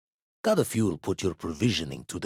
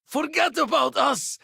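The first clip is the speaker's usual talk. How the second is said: high-pitched, urgent yell; desperate shout. Acted. Telling companion to forget about them, desperate shout.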